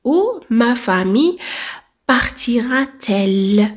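بارتيرا تيل drawn out slowly; teacher dictating the correct question slowly; slow dictation. Shown as a demonstration. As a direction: spell out the inversion for students. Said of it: "ما نقولوش بارتيرا ال".

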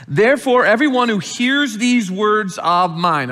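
solemn Bible reading; scripture reading. Acted. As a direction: proclaim the verse with weight. Reads scripture aloud.

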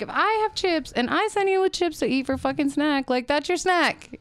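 high, loud indignant retelling; indignant mom. Retold or imitated. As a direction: lay down the law, exasperated. Mom's retort about school snack rules.

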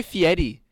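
Fieri said "fee-ED-ee". Shown as a demonstration. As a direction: put a d sound in Fieri. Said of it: "He says it with a D".

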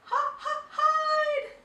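very high loud scream; panicked scream. A narrator's reading. Mouse panicking at Big Bro, high screaming cry. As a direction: scream to hide.

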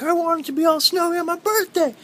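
very high, mock-childish plea; whiny birthday wish. Vlogger imitating own birthday wish, high mock plea. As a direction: put on a high little wishing voice.

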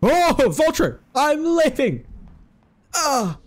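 real fright at the vulture; panicked yell. Real, not acted. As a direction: scream it as you bolt away. Vulture appears, player flees.

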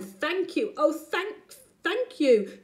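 high, heartfelt thanks; grateful. Acted. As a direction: gush with gratitude. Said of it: "the grateful witch cried".

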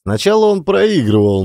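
plain reading voice

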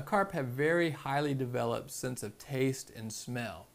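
ordinary talk

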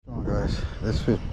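ordinary talk, nothing marked

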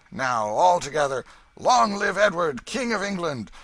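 loud, high lead for a cheer; rallying call. A narrator's reading. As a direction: call the crowd to join in. Leader calls the crowd to cheer.

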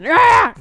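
loud, high, playful rage roar. Pretend. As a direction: let out a fun angry roar. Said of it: "I love yelling".

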